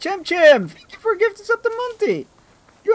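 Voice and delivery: tiny cartoon voice; squeaky high voice. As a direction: thank him in a very high squeaky voice. Thanking a donor in a put-on voice.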